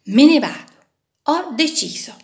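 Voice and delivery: loud, fed-up declaration; the little screw snaps that it's leaving; outburst. A narrator's reading. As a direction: burst out, done with it all. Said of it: "E allora sbottò".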